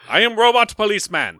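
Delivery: high stiff robot voice; clunky mechanical cop voice; toy robot voice. Pretend. Robot cop introduces himself.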